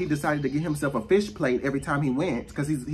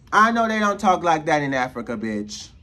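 loud, punchy repeat at natural pitch; emphatic insistence; 4 dB louder than usual. Real, not acted. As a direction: drive the words home forcefully. Snaps back to emphatic repeat of line.

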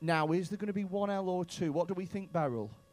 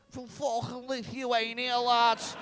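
drawn-out, raised Scouse impression; Scouse accent; 5 semitones higher, 3 dB louder, slower than usual. Comic teasing a Lithuanian about their accent, exaggerated Scouse. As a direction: put on a slow, broad Scouse. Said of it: "a little Scouse".

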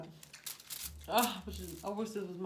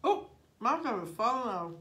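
surprised; 4 semitones higher, 5 dB louder than usual. Real, not acted. Food falling off taco.

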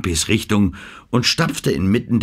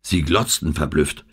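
plain reading voice

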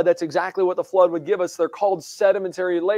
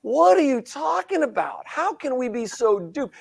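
higher, disbelieving outburst; exasperated at old-earth Christians, higher and incredulous; incredulous retort; 6 semitones higher than usual. Retold or imitated. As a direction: retell your own stunned disbelief. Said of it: "And I'm like".

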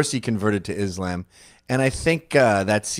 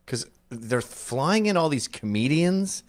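ordinary talk, nothing marked

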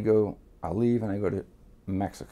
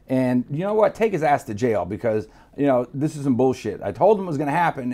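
retold indignant accusation; 7 semitones higher, 8 dB louder than usual. Retold ratting on buddy to police.

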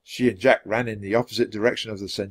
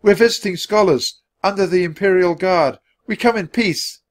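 put-on high, raised boy's declaration; yell; 9 semitones higher, 5 dB louder than usual. A narrator's reading. Jack pleading with horsemen, yelling. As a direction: call it out to armed riders. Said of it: "Jack yelled".

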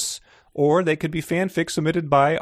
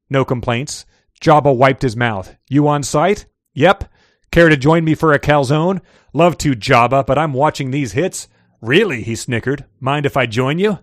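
choked; 5 dB louder than usual. A narrator's reading. Reads a fiction excerpt aloud.

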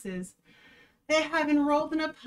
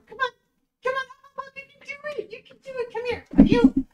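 coaxing dog voice; 14 semitones higher, 8 dB louder, much faster than usual. Real, not acted. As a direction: coax the puppy up sweetly.

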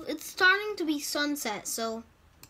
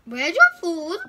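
higher, louder mock demand; silly menacing voice; 4 semitones higher, 11 dB louder than usual. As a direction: bark it at the cow like a goofy villain. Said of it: "Why am I talking like that?".

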